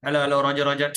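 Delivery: radio operator calling out; mock radio call; walkie-talkie voice. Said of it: "You talk like a walkie-talkie".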